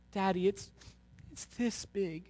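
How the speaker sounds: a hint of a small child; little boy. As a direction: speak like a tearful four-year-old. Child voiced in story.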